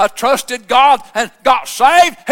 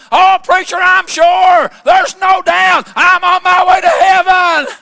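higher and louder, elated and sure; retold joyful outburst, higher and louder; overjoyed certainty; 5 semitones higher, 4 dB louder than usual. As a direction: ring it out like a man who knows he's saved. Donnie rejoicing after being saved.

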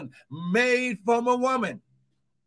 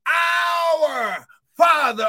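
crying out; 6 semitones higher, 5 dB louder, much slower than usual. Real, not acted. Preacher crying out prayer.

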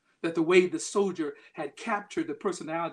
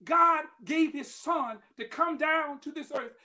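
higher, rising preacher's cadence; preaching; 8 semitones higher, 4 dB louder than usual. Acted. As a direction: drive the message home to the congregation. Preacher drives the point home.

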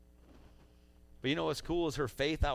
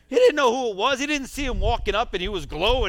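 preaching at full force; 9 semitones higher, 10 dB louder than usual. Real, not acted. Preacher building to a peak.

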